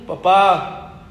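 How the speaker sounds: a nervous son faking his brother's manly voice; a hint of forced macho tone; faked manly voice. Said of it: "Aquí fingiendo la voz, con más testosterona".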